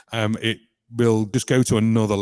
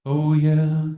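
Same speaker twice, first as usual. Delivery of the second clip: octave-up harmony effect; much slower than usual. Acted. Octave-up harmony pedal demo.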